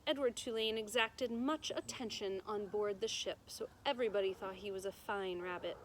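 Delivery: usual speaking voice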